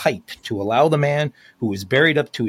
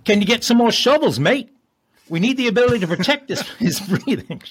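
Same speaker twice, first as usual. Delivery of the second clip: higher, urgent Aussie rescuer calling out, faster; hammed-up Australian accent; 9 semitones higher than usual. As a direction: shout it like an Australian cop mid-rescue, a bit overdone. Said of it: "my Australian or British voices".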